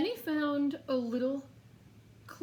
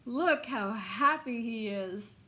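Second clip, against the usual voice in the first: ordinary delivery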